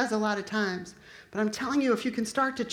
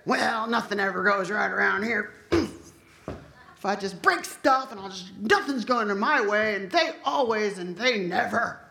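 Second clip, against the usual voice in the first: complaining voice; 2 semitones lower than usual. Pretend. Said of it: "What if I talked like that instead of".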